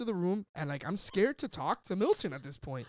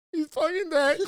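high, loud panicked yell; freaking out; 10 semitones higher, 9 dB louder than usual. Retold or imitated. Freaking out at the calm cops, yelling. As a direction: lose it completely. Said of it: "fucking freaking out".

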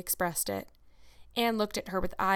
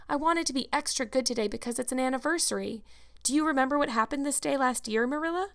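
higher-pitched, hopeful lead-up to news; lightly voiced excitable girl, lifting into the question; eager Anne; 6 semitones higher than usual. Anne reminds Marilla of the anniversary, eagerly.

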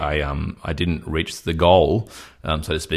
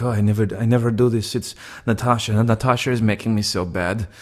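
lightly voiced Russian woman, higher pitch; retold Russian date; 4 semitones higher than usual. A man retelling his date's excuse to a friend, lightly voiced Russian woman. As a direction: imitate her pitched up, lamenting.

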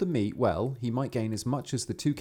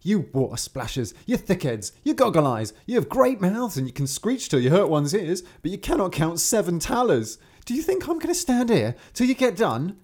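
building higher and louder insults; abusive yelling; 8 semitones higher, 5 dB louder than usual. Acted. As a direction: hurl insults, rising to a shout. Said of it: "Then he abused the frogs and cried".

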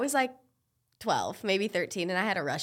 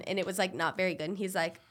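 ordinary talk

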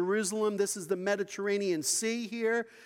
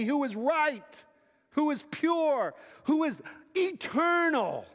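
preaching at full force; 7 semitones higher than usual. Acted. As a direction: build each "who is" like a rising sermon climax. Preaching climax on Jesus' glory.

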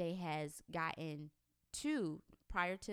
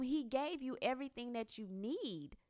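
usual speaking voice

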